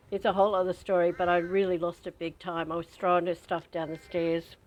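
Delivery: ordinary talk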